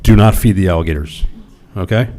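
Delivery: loud, emphatic public warning; stern plea to residents; firm warning. Real, not acted. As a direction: nearly shout it to the camera. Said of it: "public warning to residents".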